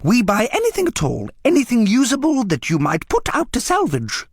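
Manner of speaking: high, wheedling junk dealer voice; coaxing dealer. A narrator's reading. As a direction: sell it like a smooth street trader. Dealer voice in read story.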